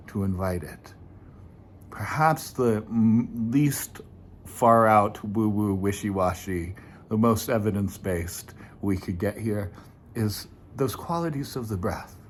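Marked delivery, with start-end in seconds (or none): none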